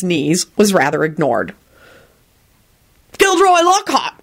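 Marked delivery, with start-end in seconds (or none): 3.2-4.1 high-pitched self-important introduction; announcing. A narrator's reading. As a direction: announce it grandly. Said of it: "Henry announced".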